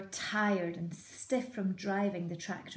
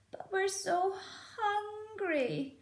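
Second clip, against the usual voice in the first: much higher, whispery plea; hungry cubs; 14 semitones higher, slower than usual. Acted. Fox cubs to their mother, high whispered plea. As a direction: whimper it. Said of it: "they cried".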